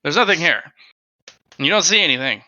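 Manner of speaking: ordinary talk, nothing marked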